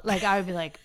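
usual speaking voice

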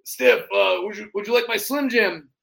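loud, high wrestler catchphrase voice; Macho King impression. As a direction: call out like a wrestling showman. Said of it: "I'm gonna do Macho King".

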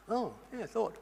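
very soft, acted sheepish reply; mock sheepishness. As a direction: imitate someone quietly trailing away. Acted person lost for answer.

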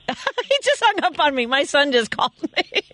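high, amused, words riding on laughter; real helpless giggle in the voice; laughing while talking. Real, not acted. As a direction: crack up as you explain what happened. Host laughs at being hung up on.

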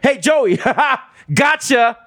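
high, laughing gotcha call. Retold or imitated. The uncle cop calling to the kid, laughing. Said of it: "he's like".

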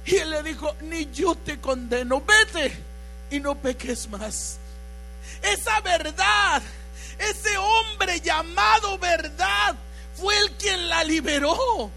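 loud shouted sermon climax; preaching at full force. Acted. (predicando a gritos con fervor)

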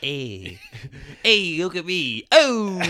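slowed, lightly voiced Blair; a hint of Blair's smarm; Tony Blair. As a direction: pretend Blair audition. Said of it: "I could play Tony Blair".

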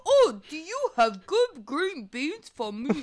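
lightly voiced dim giant. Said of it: "giants talk like this".

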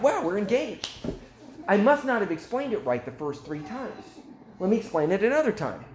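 high-pitched, eager retold sales pitch; excited salesman. Face cream seller refusing the customer's no, excited. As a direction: imitate an excited seller who won't give up. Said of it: "the Israeli gets excited while they're selling".

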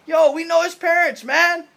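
loud, high mocking townsfolk shout; mocking crowd. Preacher voicing the scoffing crowd, loud and high. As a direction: yell it like a scoffing neighbor. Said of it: "They're like".

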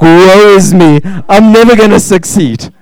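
playful mock wail of self-pity; mock despair. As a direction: wail it like a defeated suitor. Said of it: "you go like".

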